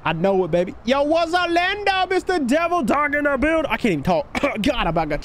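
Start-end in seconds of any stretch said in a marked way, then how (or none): 0.8-3.7 high, slow, drawn-out hype-man call; hype shoutout. Real, not acted. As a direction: stretch it out like a hype announcer. Hyped viewer welcome.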